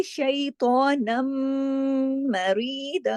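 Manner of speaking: drawn-out chanted reading; slow Quranic recitation. Shown as a demonstration. Teacher recites the phrase for the class. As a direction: recite slowly, each syllable held.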